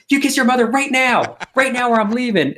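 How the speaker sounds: high, loud mock-stern parent; mock scolding. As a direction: scold the kid playfully in a high voice. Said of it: "I'm like".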